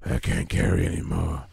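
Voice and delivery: low copy of a game line; mimicking game character. Player parroting the game character, low imitation.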